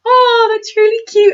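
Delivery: high, delighted coo; touched fan. Retold or imitated. Fan reacting to the droid's line, high and delighted. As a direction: melt at the droid's loyalty. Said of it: "and you're like".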